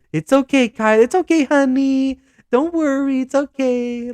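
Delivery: high, coddling voice; mock baby talk. Mocking teammates soothing Kyler, high coddling coo. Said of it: "people are trying to come over".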